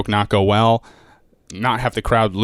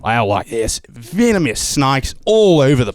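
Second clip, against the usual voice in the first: put-on Australian accent; 2 semitones higher than usual. Comic warning the host about snakes in an Aussie accent. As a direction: play the cheery Australian guide. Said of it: "accents are kind of my deal".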